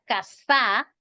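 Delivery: casa said wrongly as ca-SÁ; stress on last syllable. Shown as a demonstration. Teacher shows a hypothetical wrong word, casa stressed on SA. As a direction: push the beat onto a long final SA in casa. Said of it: "Porque si yo dijera casa".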